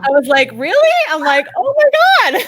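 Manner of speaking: high, loud gasps of amazement; overjoyed disbelief. Retold or imitated. Coach retelling her reaction to a client's news, overjoyed disbelief.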